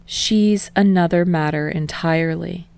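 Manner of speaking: usual speaking voice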